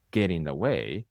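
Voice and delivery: ordinary delivery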